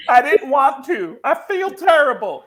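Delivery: high, loud comic sobbing; hammed-up tearful remorse, voice way up; imitated crying. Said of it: "and he starts crying".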